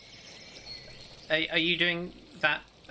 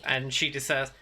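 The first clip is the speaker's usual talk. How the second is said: normal talking voice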